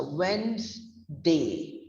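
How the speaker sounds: "Wednesday" as WENZ, then DAY; silent first D. Shown as a demonstration. Teacher repeating Wednesday after spelling it, WENZ-day. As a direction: say "Wednesday" in two slow parts, wenz-day. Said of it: "The spelling is W E N Z D".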